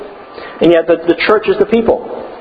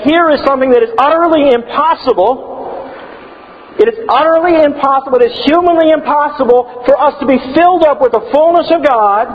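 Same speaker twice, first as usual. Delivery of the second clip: high, urgent voicing of Paul; awed; 9 semitones higher than usual. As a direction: marvel at the impossible. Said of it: "Paul, as he's gone through and said".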